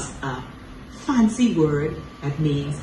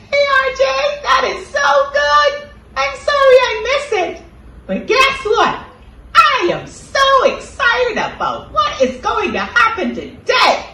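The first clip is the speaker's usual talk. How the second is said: very high, loud excited puppet; squeaky puppet voice; 7 dB louder than usual. Acted. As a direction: squeal it like an excited cartoon kid. Second puppet answers RJ.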